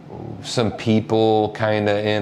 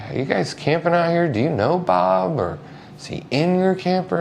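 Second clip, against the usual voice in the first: questions asked higher, a little fast at first; high questioning; 11 semitones higher than usual. Retold or imitated. Funeral worker asks campers about Bob, high and careful. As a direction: ask high and careful, unsure you have the right place. Asking strangers for Bob Smith.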